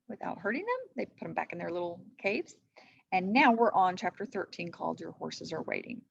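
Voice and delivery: plain reading voice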